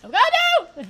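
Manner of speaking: high, very loud cry of dismay; real panic, breaking into laughter after; panicked scream. Real, not acted. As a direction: shriek it as it all goes wrong. Disaster in game, then laughs.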